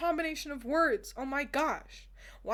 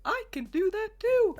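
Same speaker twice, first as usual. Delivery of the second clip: much higher, louder synthetic singsong; 10 semitones higher, 4 dB louder than usual. Retold or imitated. Miku delighted at the transformation, high robotic voice. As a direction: squeak it like a vocal synth. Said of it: "with her robot voice".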